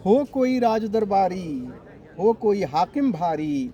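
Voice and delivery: rhythmic rhymed verse, closing lines dropping away; poem recitation. Acted. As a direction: recite in short cadenced lines. Recites second translated poem. (लयबद्ध कविता पाठ)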